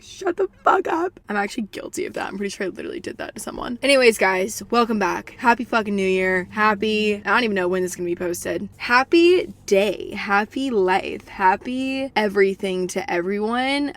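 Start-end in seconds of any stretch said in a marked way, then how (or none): 0.0-1.2 slow, high mock exasperation; annoyed retort. Friend mocking the next-year joke, slow, high mock exasperation. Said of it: "And you're like". 8.8-13.9 drawn-out well-wishing. Acted. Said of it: "I don't know where I'm going".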